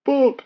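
high, loud comic boop like a bump; mock crash noise. Retelling a lorry hitting the buffers, comic high boop. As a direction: blurt a cartoon boop sound.

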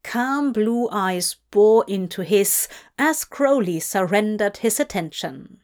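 ordinary delivery

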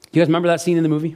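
normal talking voice

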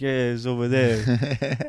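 slow, bored brush-off; a touch of a curt owner waving them off; dismissive. Retold or imitated. Owner pointing them to a table, slow offhand brush-off.

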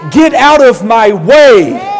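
slow, loud shove of a line; a desperate woman forcing her way to Jesus, shouting; forceful shout. Retold or imitated. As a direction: push through the crowd with your voice. Woman crawling through the crowd.

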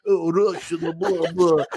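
mumbled mock-Russian gibberish; fake Russian accent. Shown as a demonstration. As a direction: toss off a bad wrestling-villain Russian accent. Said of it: "with my fake ass".

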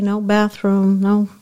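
normal talking voice

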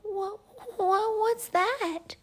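put-on very high, stammering child voice; frightened stutter. A narrator's reading. Albie startled by the owl, high stutter. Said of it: "stuttered Albie".

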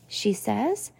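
usual speaking voice

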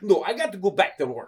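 mock Russian flat-out refusal; Russian accent. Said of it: "this Russian guy".

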